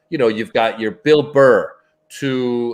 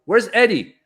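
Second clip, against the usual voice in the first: higher-pitched calling out to staff; mock bewildered question, pitched up; retold puzzled query; 7 semitones higher than usual. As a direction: imitate asking around for someone missing. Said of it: "Paulie starts talking to the staff".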